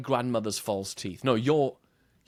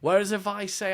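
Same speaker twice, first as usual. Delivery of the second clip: usual speaking voice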